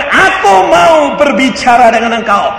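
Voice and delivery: suara tinggi dan lantang menirukan Tuhan; seruan Tuhan. Retold or imitated. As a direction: serukan seperti sabda Tuhan. Suara Tuhan dikutip pengkhotbah. (seruan lantang bernada tinggi)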